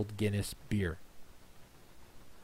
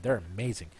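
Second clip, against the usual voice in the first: ordinary talk, nothing marked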